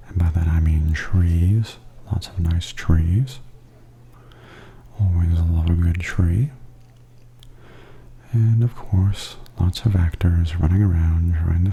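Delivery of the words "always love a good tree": ordinary delivery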